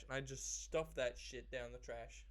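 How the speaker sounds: ordinary talk, nothing marked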